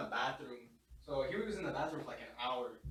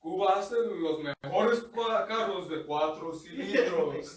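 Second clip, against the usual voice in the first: slow, loud, high rambling Spanish; spaced-out guy; 5 semitones higher, 11 dB louder, slower than usual. Retold or imitated. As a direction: stretch it out like a man who is high. Voicing the drugged man's nonsense.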